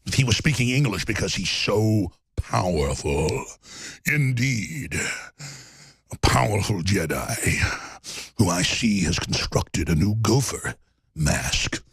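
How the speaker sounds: low, slow trailer voice; deep dramatic movie voice. Pretend. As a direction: intone it like an epic villain intro. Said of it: "This is how Kim Jong-un would sound".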